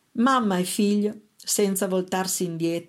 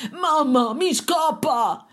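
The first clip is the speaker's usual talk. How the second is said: put-on urgent child; 8 semitones higher, 4 dB louder, slower than usual. A narrator's reading. Son desperate.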